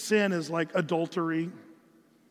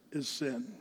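usual speaking voice

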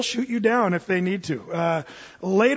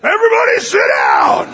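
much louder, higher barked order; booming shout; 10 semitones higher, 10 dB louder than usual. Retold or imitated. Towering operator ordering panicked passengers, booming shout. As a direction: boom it out like a giant commanding the car. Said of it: "with his huge booming voice".

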